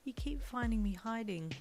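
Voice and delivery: sad. A narrator's reading. Shy character continuing her sad apology.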